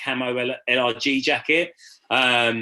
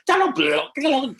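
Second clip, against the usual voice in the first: imitating voices; 10 semitones higher, slower than usual. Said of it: "did every single one".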